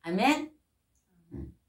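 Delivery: ordinary talk, nothing marked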